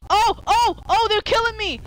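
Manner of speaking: high panicked cries; panicked wail. Real, not acted. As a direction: squeal while being attacked. Zombies killing him in game.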